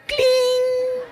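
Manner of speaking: very high, sustained ringing note; phone chime. Retold or imitated. Father's phone going off after he yells, imitated chime. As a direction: imitate a text alert ding.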